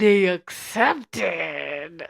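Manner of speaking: hugely drawn-out, gloating reveal; slow, savoured big news; triumphant announcement. Real, not acted. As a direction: stretch every syllable in triumph. Gleeful announcement of acceptance.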